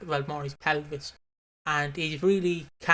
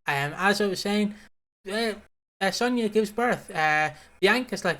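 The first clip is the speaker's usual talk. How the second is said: usual speaking voice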